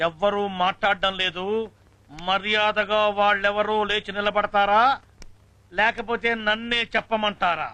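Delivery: slow, drawn-out schoolmaster warning; stern threat. Acted. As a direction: stretch each phrase as a menace to the class. Teacher demands the culprit stand. (నెమ్మదిగా సాగదీస్తూ బెదిరింపు)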